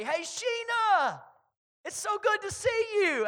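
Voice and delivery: high, drawn-out, delighted call; called-out greeting. Retold or imitated. Pastor greeting Sheena thirty yards down the lobby, calling out delightedly. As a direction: call out an effusive welcome across the distance.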